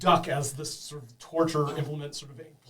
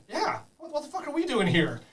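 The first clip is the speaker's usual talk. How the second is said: high squeaky kobold; high-voiced kobold questioning the boss; 12 semitones higher than usual. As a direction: pitch it up like a panicky little kobold.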